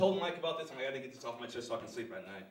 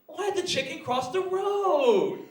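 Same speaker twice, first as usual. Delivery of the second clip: high, drawn-out begging for a laugh; unfunny pleading friend; 10 semitones higher, 7 dB louder, much slower than usual. Retold or imitated. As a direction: imitate a needy joker, stretching the line endings. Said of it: "there was always this guy in our crew".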